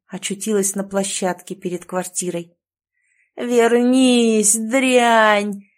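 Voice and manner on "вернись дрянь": howled, raised and harsh; howling old woman. A narrator's reading. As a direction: howl it like a possessed old woman. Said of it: "провыла".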